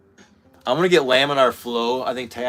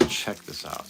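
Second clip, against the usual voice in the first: soft, hushed whisper; 4 semitones lower, 11 dB quieter than usual. Real, not acted. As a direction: breathe it like a secret. Showing a tooth secretly.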